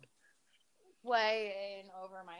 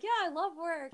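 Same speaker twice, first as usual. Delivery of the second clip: fake cheerful; 8 semitones higher, 3 dB louder than usual. Mimicking her sister's fake cheer.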